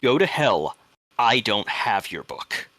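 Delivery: angry refusal. Acted. Homeowner answering the old man at the window, angrily defiant. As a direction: throw the demand back forcefully. Shouting back at the intruder.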